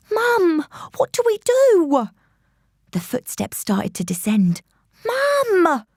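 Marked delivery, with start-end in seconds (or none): 0.1-2.1 high, urgent panicked plea; panicking. A narrator's reading. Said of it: "I was panicking now". 5.1-5.8 high urgent cry of Mum; a frightened girl's sharp call; panicked call. A narrator's reading. Girl panicking in hiding.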